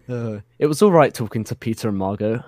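ordinary delivery

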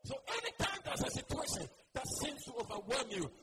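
rising, fervent exhortation; full-force preaching. Acted. Preacher urging the church to boast in God, fervent. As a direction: build it up and drive it home.

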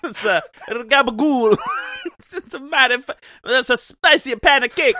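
loud, high, hammed-up "gabagool" voice; loud goofy mock voice, laughing through it; goofy mobster voice. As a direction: play a cartoon Italian-American mobster, cracking up.

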